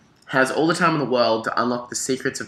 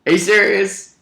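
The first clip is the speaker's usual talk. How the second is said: disbelief; 8 semitones higher, 6 dB louder, slower than usual. Retold or imitated. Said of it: "And I'm like".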